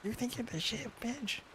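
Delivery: very high, soft cartoon squeak; badly faked squeaky cartoon taunt; Toad. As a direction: attempt a tiny squeaky character voice and give up. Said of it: "I can't do a Toad voice".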